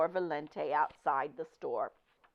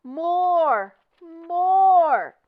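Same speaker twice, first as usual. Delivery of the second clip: loud, high chant of a cheering crowd; crowd shouting; 12 semitones higher, 12 dB louder than usual. Acted. Shoppers shouting for an encore. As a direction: shout for more like an eager audience.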